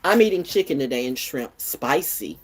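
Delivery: ordinary talk, nothing marked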